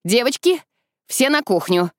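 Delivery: put-on brisk order to the girls, opening high; rally them with a firm command; mildly acted command. A narrator's reading. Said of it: "мисс Кайтли скомандовала".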